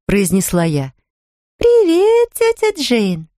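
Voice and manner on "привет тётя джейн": high squeaky voice for the newborn; squeaky baby voice. A narrator's reading. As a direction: speak for the baby in a tiny squeak. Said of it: "всё тем же писклявым голоском".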